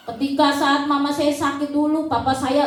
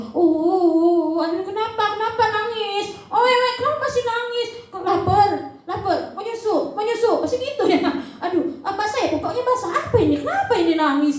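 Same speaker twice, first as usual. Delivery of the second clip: fussing parent; 4 semitones higher than usual. Retold or imitated. Parent fussing over baby.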